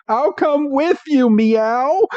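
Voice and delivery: high squeaky cat voice; Meowth voice. Retold or imitated. Podcaster voicing Meowth for his brother, high. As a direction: pitch way up like the cartoon cat. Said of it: "I love doing the Meow stuff".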